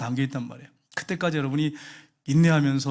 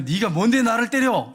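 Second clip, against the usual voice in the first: higher, slow indignant backtalk; defiant protest; 5 semitones higher, 4 dB louder, slower than usual. Retold or imitated. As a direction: talk back like an outraged daughter. Daughter defies mother, acted. (대들며 따지는 말투)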